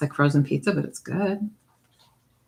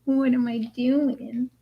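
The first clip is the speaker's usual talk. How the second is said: voicing the dog; 7 semitones higher than usual. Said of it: "You're like".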